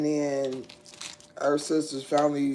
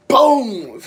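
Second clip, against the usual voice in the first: loud boom sound; 9 semitones higher, 12 dB louder than usual. Retold or imitated. Retelling the crash, laughing.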